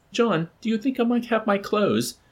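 high, small coaxing voice; timid, ingratiating request. A narrator's reading. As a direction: ask meekly through a closed door.

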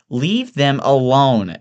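high, slow, drawn-out protest; protesting Evelyn. Acted. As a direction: stretch it out reproachfully. Evelyn defends her friends.

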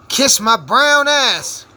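slow, loud and high, spelling out each word; loud defiant. Real, not acted. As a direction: drag it out and shout it. Defiant punchline at racist demons.

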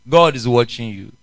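ordinary talk, nothing marked

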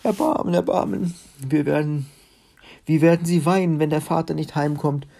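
high, loud imitation of a bawling beggar; hammed-up wail, begging for mercy; mock wailing plea. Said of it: "ringt die Hände und plärt". (übertrieben plärrendes, hohes Flehen)

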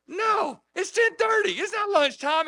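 very high, strained mock yell; high-pitched imitation of shouting; retold yelling back. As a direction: holler back over factory noise. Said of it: "I'm over there yelling at him".

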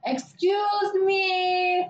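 very drawn-out, high mock call; insistent customer. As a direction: imitate her calling, stretching the last word. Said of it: "She just keeps going".

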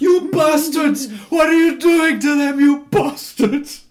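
high, loud, slow anguished yell; movie scream. Retold or imitated. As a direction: scream it like a horror film victim. Quoting Saw scream.

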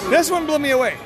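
ordinary talk, nothing marked

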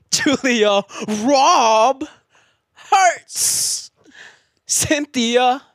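high strained dramatic pitch; slam poetry delivery. Pretend. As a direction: wail the pun, slam style. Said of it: "Angry. Yelling".

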